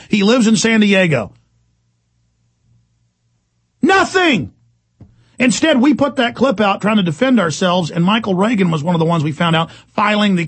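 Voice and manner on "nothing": a very high, bitter cry; incredulous outburst; outraged. Real, not acted. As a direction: hit it with disbelief. Outraged that FBI did nothing.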